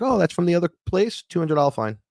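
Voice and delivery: high, prissy airport officer voice; lightly sing-song bureaucrat; mock official.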